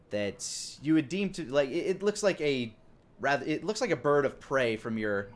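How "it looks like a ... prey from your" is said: normal talking voice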